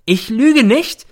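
high, loud drawn-out yell; sudden yell. A narrator's reading. Mother suddenly erupts at her son, yelling. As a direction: burst out at the top of the range. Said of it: "brüllt sie plötzlich los".

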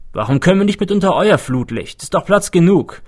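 worked-up, pushy protest pitched high; quarrelling complaint. A narrator's reading. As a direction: gripe loudly at a selfish neighbour. Overheard quarrel over floodlight.

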